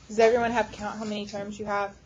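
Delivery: usual speaking voice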